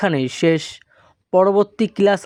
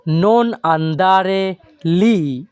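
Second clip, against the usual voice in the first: stretched-out syllables, very slow; slow model phrase; much slower than usual. Shown as a demonstration. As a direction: lay each word out for the class. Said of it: "এই বাক্যের Italian হবে". (খুব ধীরে উচ্চারণ)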